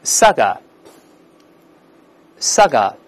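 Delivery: saga ending in an open uh, no r; model pronunciation. Shown as a demonstration. Teacher leads students reading aloud, saga with no final r. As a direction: say saga clearly, tongue uncurled at the end. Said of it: "跟老师大声再读一遍".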